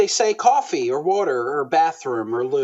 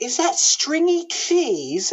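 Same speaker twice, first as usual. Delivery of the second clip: high, slow, camp disbelief over stringy cheese; a hint of a camp British director, drawn out and high; mock camp British director; 11 semitones higher, much slower than usual. Actor mimics his British director scorning string cheese, high and slow. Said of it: "And he's like".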